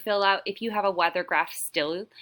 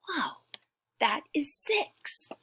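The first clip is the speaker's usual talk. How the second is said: mock wonder; 8 semitones higher, 4 dB quieter, slower than usual. Marvelling at the dice.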